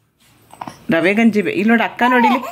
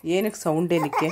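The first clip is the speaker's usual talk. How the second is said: soft night soothing; 2 semitones lower, 6 dB quieter than usual. Real, not acted. Mother softly hushing her crying baby at half past two at night.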